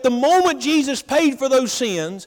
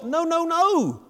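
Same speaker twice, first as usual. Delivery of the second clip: a high, firm no no no; emphatic rejection; 7 semitones higher, 3 dB quieter, slower than usual. Real, not acted. Preacher correcting the congregation's mistaken belief, emphatic denial. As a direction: wave the wrong idea away.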